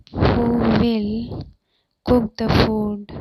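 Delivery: drawn-out "Who will", pause, then the rest; slow it right down for learners; deliberate chunked teaching delivery; slow, emphatic repeat. Shown as a demonstration. Teacher repeats translation slowly in chunks.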